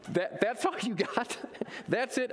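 high, laughing taunt; mocking hyena. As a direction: sneer at the tiny roar. Hyenas mock the cub.

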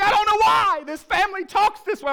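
loud, high-pitched wail of an upset wife; hammed-up tearful complaint; mock crying. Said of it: "Kate starts crying".

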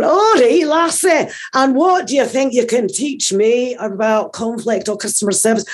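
high-pitched, challenging Scottish driver; Scottish accent. Retold or imitated. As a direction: put on a sceptical Scots "lassie" put-down. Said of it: "they did it in a Scottish accent".

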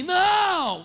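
loud, high-pitched mock 'no'; retold cry of protest. Retold man refuses the prayer offer. (grito de protesto imitado, alto e agudo)

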